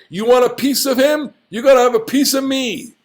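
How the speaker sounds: high, quick, heroic dare; fierce protective bravado; defiant challenge. Acted. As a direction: stand up to the bullies. Voicing a hero's defiant challenge.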